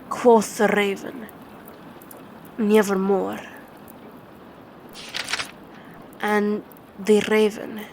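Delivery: slow, grave reading with long pauses; dramatic poem recitation. Acted. Reciting Poe's poem to a baby, dramatic and grave. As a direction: read it like a haunted night.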